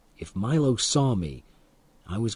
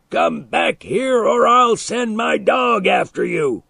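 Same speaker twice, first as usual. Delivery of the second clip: high, loud, slow furious threat; screamed threat; 9 semitones higher, 4 dB louder than usual. A narrator's reading. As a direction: shriek the threat after him. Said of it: "Milo screamed".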